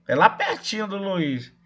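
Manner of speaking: ordinary delivery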